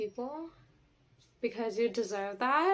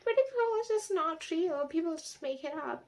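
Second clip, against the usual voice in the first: much higher, snooty mock voice; mocking delusional people; 6 semitones higher than usual. Mocking people who deny pretty privilege, in a snooty high voice. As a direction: parody the naive skeptic. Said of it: "delusional people who were like".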